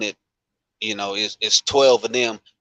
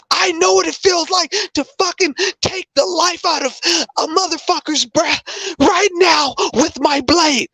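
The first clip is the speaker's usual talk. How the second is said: much higher, louder, near-crying furious shout; imitating an enraged breakdown; 4 dB louder than usual. Veteran breaking down at work over his military blade, imitated enraged crying shout. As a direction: act out a shaken veteran losing control. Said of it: "It's like".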